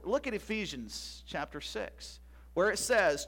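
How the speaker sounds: ordinary talk, nothing marked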